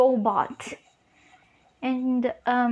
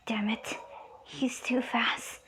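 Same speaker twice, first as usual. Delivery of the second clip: whispered thought; 2 semitones lower, 9 dB quieter than usual. Retold or imitated. Kumo thinks mid-fight about a too-fast foe, whispered thought. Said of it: "Kumo starts to think".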